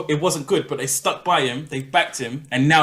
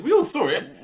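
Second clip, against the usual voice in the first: much higher, pressing the point; insistent repeat of the claim; emphatic; 12 semitones higher than usual. Real, not acted. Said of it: "Oh, we all saw it".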